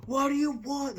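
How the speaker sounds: high shouted bark at the customer; mock waitress yell. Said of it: "As soon as you walk through".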